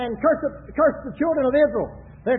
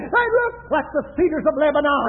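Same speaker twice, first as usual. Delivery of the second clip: raised, shouted sermon delivery; full-force preaching; 3 semitones higher, 6 dB louder than usual. Acted. Preacher pictures Israel's tents for the congregation, full-force preaching. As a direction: preach it out with long, cried-out openings.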